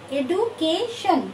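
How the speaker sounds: Education slowly in three parts, এডু, কে, শন; syllable groups separated. Shown as a demonstration. Teacher repeating the count for students, education broken in three. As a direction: split education into three clear beats. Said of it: "তিন, তিনবার হলো না".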